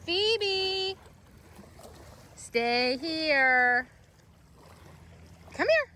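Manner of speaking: high sing-song call to the dog; bright high dog call across the room. Real, not acted. Calling the dog back.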